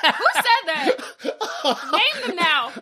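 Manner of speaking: playful mock indignation; mock outrage. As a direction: pretend to be offended.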